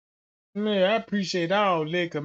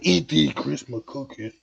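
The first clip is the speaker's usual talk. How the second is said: aggressive demand; 5 dB louder than usual. Retold or imitated. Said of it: "they talking aggressive now".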